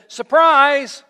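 loud, high playful shout of surprise; spring it on them, big and bright; mock surprise reveal. Pastor voicing Jesus jumping out, loud playful surprise.

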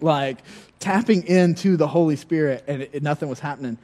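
usual speaking voice

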